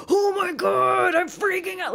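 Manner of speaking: high, loud, drawn-out exasperated cry; mock freak-out. Streamer imitating someone stuck on a puzzle game, mock freak-out. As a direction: act out a frustrated puzzle player losing it. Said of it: "you need someone to go like".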